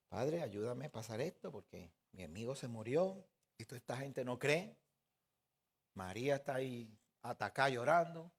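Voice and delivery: low, soft acted prayer; hushed plea. Yeshua asks the Father for help, hushed plea. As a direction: pray quietly under the weight of grief.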